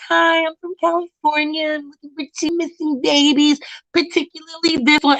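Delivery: high, sugary outsider introduction; overly polite stranger. Retold or imitated. Asking locals about the missing babies, high and sugary. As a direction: put on a sweet polite visitor voice. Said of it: "'cause I'm like".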